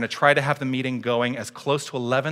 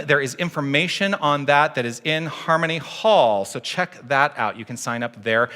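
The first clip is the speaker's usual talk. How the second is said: ordinary delivery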